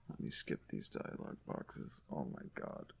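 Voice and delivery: tired whispered grumble; weary whisper. Real, not acted. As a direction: whisper it, worn out by the game. Weary aside to self.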